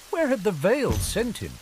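plain reading voice

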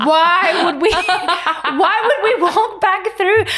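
high, loud wail with laughter in it; shrieked mock outrage; exasperated shout. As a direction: shout in hammed-up disbelief, drawing words out. Acted outraged reply to partner.

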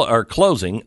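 ordinary delivery

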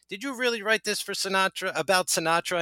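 lightly voiced singer questioning a writer. Voices [name] questioning the writer.

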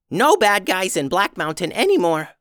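high goblin voice, firm; a small goblin laying down the law; stubborn little goblin. Acted. Goblin declares.

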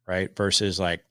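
ordinary talk, nothing marked

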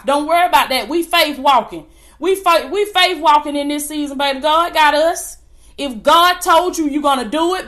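forceful, rhythmic sermon delivery; fervent preaching. Real, not acted. Preacher urging believers to keep faith, fervent preaching. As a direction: preach with full conviction, driving each phrase.

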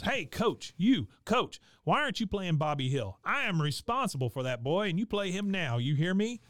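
hammed-up bleacher heckle, pitched well up; yelling at coach.

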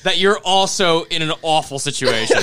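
loud, high, slow words through laughter; laughing relief. Real, not acted. Relieved cohost shares the awful situation, laughing loudly. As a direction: gush with relief while laughing.

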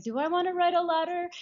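high, musing question to oneself; self-questioning. Retold or imitated. Asking herself, acted out.

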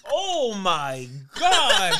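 slow, laughing oh my God. Real, not acted. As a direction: drag it out through laughter. Reeling after a whiff.

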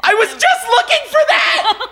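cracking up at the coincidence, yelling; delighted laughing yell; excited laughing shout. Real, not acted. Finding the exact venue.

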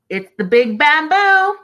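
loud, high, rising singsong announcement; playful exclamation. Real, not acted. Host echoing a viewer's cheeky line, playful shout. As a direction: belt it out with a climbing, stretched last word.

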